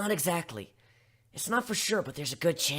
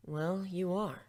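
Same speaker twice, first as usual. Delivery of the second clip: ordinary delivery, nothing marked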